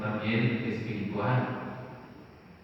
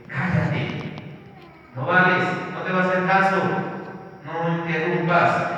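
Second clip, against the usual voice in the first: loud, raised hushing rebuke; callando al ciego; 5 semitones higher, 4 dB louder than usual. Acted. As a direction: shut him up like an impatient crowd. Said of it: "Y la gente lo calla". (regaño fuerte para callarlo)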